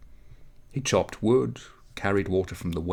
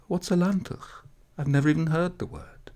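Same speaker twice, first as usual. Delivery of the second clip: mock innocence; 9 semitones higher than usual. A narrator's reading. Mirele feigns innocence.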